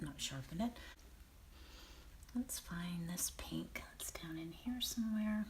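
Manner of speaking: ordinary talk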